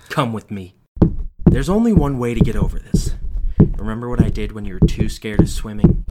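loud, cold threat; menacing father. A narrator's reading. Father dragging child to face his fear. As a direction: force the child along.

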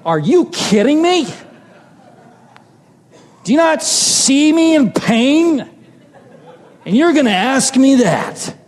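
high, incredulous outburst retelling his hurt; retold indignation. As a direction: act out pained, indignant disbelief, voice shooting up. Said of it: "That was my feeling".

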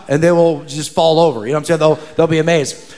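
normal talking voice

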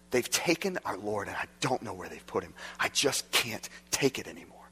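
soft, lightly voiced heartbreak; grieving Mary.

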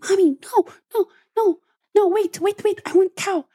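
put-on high whiny little boy protest; sleepy child whine. Mother imitating her sleeping son's high whine.

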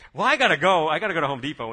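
high, loud, startled blurt; imitated startled. Startled worker excusing himself to leave after a prayer, mimicked high and loud. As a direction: blurt it out loudly, mimicking someone rattled. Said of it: "He was pretty startled".

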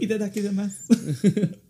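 anime-style exclamation. Pretend.

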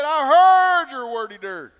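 loud, high, drawn-out answer; re-enacted emphatic shout; shouted reply. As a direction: holler it back slowly for comic effect. Acts out his own emphatic reply.

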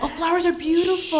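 gushing admiration. Retold or imitated. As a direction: coo over the flowers. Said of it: "And you're like".

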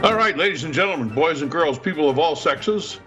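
booming ringmaster welcome, high and fast. Pretend. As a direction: announce it like a circus ringmaster. Podcast host's grand opening announcement.

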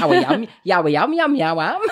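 a laughing, hammed-up Wolverhampton accent; giggling Wolverhampton accent parody; mock Wolverhampton accent. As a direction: send up the Wolverhampton accent in nonsense sounds. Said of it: "I started talking with the Wolverhampton accent".

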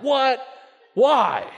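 sharp, high disbelief; a loud, high burst of shock; incredulous what. Retold or imitated. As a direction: react like you can't believe it. Store clerk says color unavailable.

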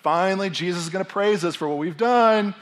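hammed-up excited disciples; eager anticipation. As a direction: act thrilled that praise is coming. Disciples eagerly expecting praise.

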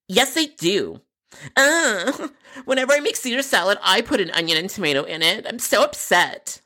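high-pitched, dramatically upset caller; hammed-up aggrieved customer.

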